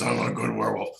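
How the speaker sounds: low, growly werewolf voice; good werewolf. Retold or imitated. Werewolf answering a fearless kid, low growly voice. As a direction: drop deep into a friendly monster's voice. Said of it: "And I go".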